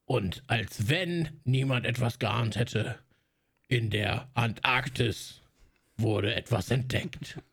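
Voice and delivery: slow, low movie trailer voice; dramatic trailer narrator, drawn out; klassischer Trailersprecher. Retold or imitated. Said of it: "Also der dann wirklich sagt so". (tiefe, langsame Trailerstimme)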